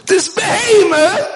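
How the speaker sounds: high, loud and slow "behemoth"; emphatic repeat. Shown as a demonstration. Preacher repeating "behemoth" to drive the point home, loud and slow. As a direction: stretch out "behemoth" loudly for impact. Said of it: "And this behemoth".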